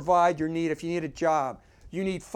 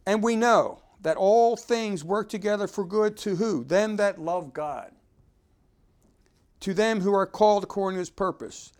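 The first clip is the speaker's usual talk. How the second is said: loud, raised preaching read; scripture reading; 5 semitones higher, 5 dB louder than usual. Acted. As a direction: proclaim the verse to the congregation. Reads scripture aloud at force.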